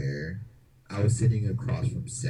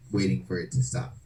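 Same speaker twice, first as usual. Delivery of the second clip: ordinary delivery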